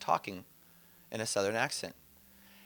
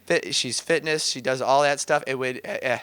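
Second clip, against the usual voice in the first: ordinary talk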